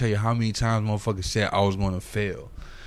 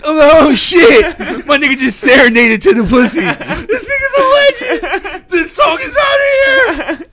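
much higher and louder, laughing shouts, slowing at the end; ecstatic retold hype; 12 dB louder, slower than usual. Hearing the finished song, laughing screaming hype. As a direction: scream it like you just heard a classic. Retelling hearing his hit record.

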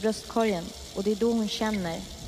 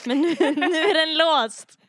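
eerie laughing taunt; 7 semitones higher, 9 dB louder, slower than usual. Acted. Narrator eerily corrects co-teller.

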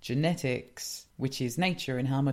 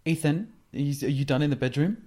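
passive aggressive; 2 semitones higher, 4 dB louder, faster than usual. Retold or imitated. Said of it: "you get all passive aggressive".

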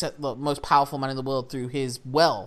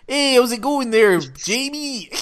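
loud, higher mock Canadian greeting; hammed-up friendly Canadian hello; jokey Canadian buddy calling out; 8 semitones higher, 7 dB louder than usual. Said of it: "his Canadian spy mate will be like".

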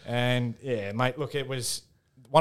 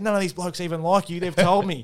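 laughing mock sledge; 7 semitones higher than usual. Retold sledge on field.

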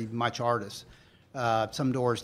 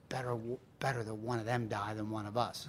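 deadpan dying man; 4 semitones lower, 14 dB quieter than usual. Retold or imitated. Dying man's deadpan punchline.

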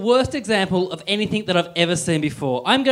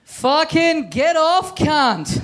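high, slow, abusive heckler yell; angry heckle; 8 semitones higher, much slower than usual. Acted. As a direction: drawl the abuse at the comic.

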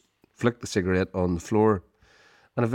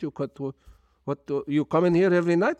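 put-on high, incredulous foreign-accented grilling; a big Turkish owner quizzing the drunk student; Turkish kebab shop owner; 9 semitones higher than usual. Shop owner grills drunk student, high incredulous accented voice. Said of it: "He said".

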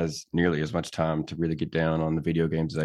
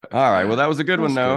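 loud amused reaction; 8 semitones higher, 6 dB louder than usual. Real, not acted. Reacting to the joke.

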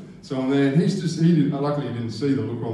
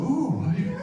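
high rising surprised question; curious clerk; slower than usual. Retold or imitated. The clerk hearing he leaves tomorrow, high surprised. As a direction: perk right up in surprise. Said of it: "he said".

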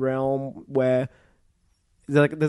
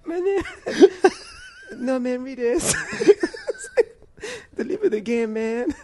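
high put-on accent broken by laughter; full Jeff voice. Cracking up while doing the Jeff voice for co-host. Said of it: "I accidentally went full Jeff".

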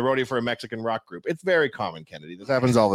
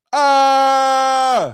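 high, loud Home Alone scream; mock scream; 12 semitones higher, 7 dB louder than usual. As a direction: scream like Kevin slapping his cheeks. Retold Home Alone face scream.

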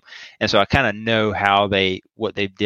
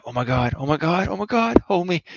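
high, hammed-up gasping at the big screen; mock thrill; 9 semitones higher than usual. Mocking an overexcited moviegoer, squealing high. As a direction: squeal like a terrified fan on the edge of the seat. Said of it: "and just being like".

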